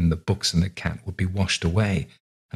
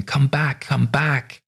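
distant calling; 8 semitones higher, much slower than usual. A narrator's reading. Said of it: "far away ... a voice calling".

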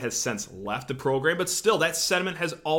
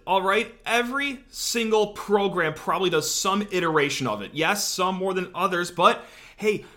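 normal talking voice

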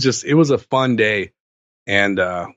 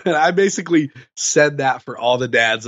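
normal talking voice

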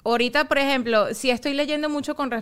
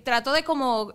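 ordinary talk

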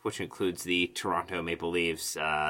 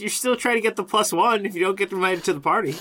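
high, loud and fast; laughing excited riff; 11 semitones higher, 5 dB louder, faster than usual. Real, not acted.

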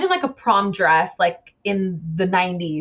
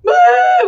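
high, loud imitated bawl; mock crying wail; 8 dB louder than usual. Retelling how she and mom burst out crying at the bridal store, mock wail. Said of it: "we just started crying".

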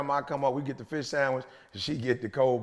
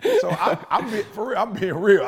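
loud, high, laughing confession; 9 semitones higher, 8 dB louder than usual. Real, not acted. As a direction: crack up while insisting. Laughing at own mistake.

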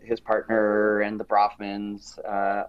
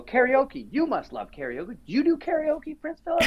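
playful teasing. Retold or imitated. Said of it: "I go".

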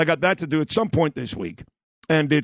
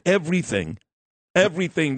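Everything. said twice with a firm fall; insistent repetition. Real, not acted. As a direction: lean on Everything. as the whole point. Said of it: "No, you don't understand".

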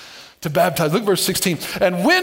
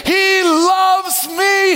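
high, loud, slow He loves me; shouted affirmation; 11 semitones higher, 4 dB louder, much slower than usual. Real, not acted. Preacher showing the church how to mean it, shouted. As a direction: belt it out with conviction.

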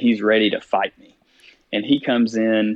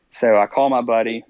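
usual speaking voice

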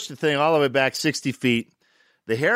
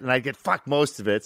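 ordinary talk

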